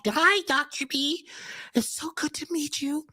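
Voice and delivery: high, soft, put-on sugary caller; mock the fake-nice phone voice; fake sweet voice. Lady on the phone greets Doctor B in a high, soft fake-sweet voice. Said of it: "They get a voice. It's a special voice".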